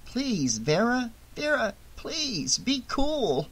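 high, soft, urgent plea; hushed, high-pitched begging; anxious pleading. A narrator's reading. Frightened Shelley pleads with Vera.

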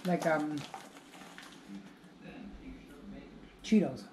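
talking between bites; chewing while talking. Real, not acted. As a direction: mouth partly full. Seen 0: Woman speaking holds a puff by her mouth, appears to be chewing.

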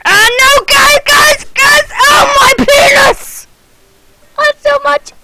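mock distress shout; mock panicked shout. Real, not acted. Distressed cry in game.

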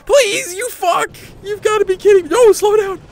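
high, loud exasperated yelling; frantic shout. Real, not acted. Shouting at game speeding out of control.

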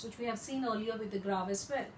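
ordinary talk

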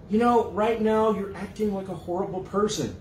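a plain, level rebuke in a slightly changed voice; mildly voiced calm reply. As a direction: state it evenly, as the one accused. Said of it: "You might say".

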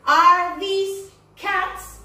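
drawn-out teacher model of "Are these cats?"; slow emphatic model question. Shown as a demonstration. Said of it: "lo que tenemos que enfatizar".

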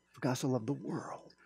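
hushed, reverent verse; preacher whispering John three sixteen; whispered scripture. Real, not acted.

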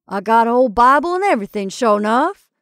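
hearty loud boast. Acted. Jumbo showing his Bible to the crowd, hearty. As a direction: brag about the Bible.

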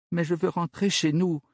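high sobbing outburst; sobbing cry. Acted. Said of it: "s'écria-t-elle en sanglotant". (cri en sanglots, aigu)

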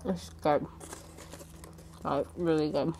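speaking with food in mouth; chewing while talking. Real, not acted. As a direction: mouth partly full. Seen 0: Bite at -5; closed, working mouth while speaking, sandwich in hand.

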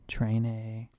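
"Train a" run together as train-ay; mock Spanish ending. Shown as a demonstration. Teacher invents Spanish for students, "Train a" as train-ay. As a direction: tack a drawn "ay" onto "Train a". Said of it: "just add a".

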